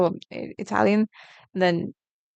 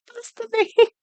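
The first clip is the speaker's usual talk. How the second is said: much higher, squeaky silly repeat of "Pasta name"; squeaky mock echo. Streamer mocking her own name, squeaky high "Pasta name".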